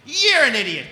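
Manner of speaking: high, loud jeer; heckling shout. Retold or imitated. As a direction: yell it across at a politician. Heckling a politician mid-speech.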